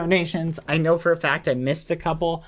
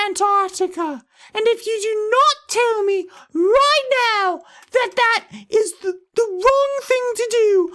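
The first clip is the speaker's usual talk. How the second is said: very high falsetto, hammed-up panic; frantic mock viewer; 4 dB louder than usual. Mimicking a viewer demanding advice on moving to Antarctica, shrill mock panic. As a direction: squeal it like a desperate, over-dramatic fan.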